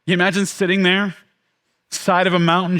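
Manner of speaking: usual speaking voice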